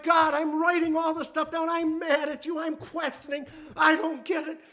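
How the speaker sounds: high, anguished plea to God; crying out. Acted. Preacher voicing Job questioning God, crying out. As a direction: cry out in protest, voice lifted. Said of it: "he's crying out".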